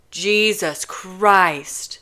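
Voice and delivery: very slow, weary groan of words; drawn-out exasperation; exasperated. Acted. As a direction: drag it out in despair. Kenji exasperated.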